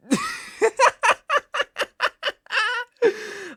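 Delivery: long, high, loud cackle; villainous laugh. Acted. Captor gloats at the thought he wouldn't, high cackle. Gloats over threatened family.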